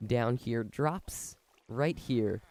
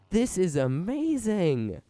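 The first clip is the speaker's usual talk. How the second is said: higher, louder and slower with awe; thrilled; 7 semitones higher, 5 dB louder, slower than usual. Real, not acted. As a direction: savor each word in delight. Delighted at working machine.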